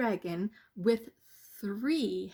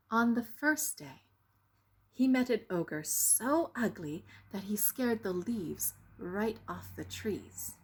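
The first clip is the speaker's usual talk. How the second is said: ordinary delivery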